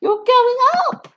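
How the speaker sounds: high, loud cry of alarm; boy shouting at rising Grandma. Acted. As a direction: call out as she rises. George yelling.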